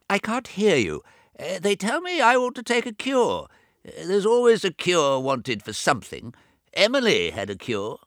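put-on high, loud old man raising his voice over deafness; a fussy deaf old man talking too loud; deaf old brother. Deaf old James to his bedridden twin, high and loud.

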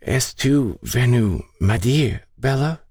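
high, very slow quavering French; confused old woman, drawn out and high. A narrator's reading. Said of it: "in a quavering voice".

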